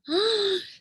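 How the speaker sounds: sharp high alarmed gasp. Acted. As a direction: gasp as the sister cries out. Sisters hear her call.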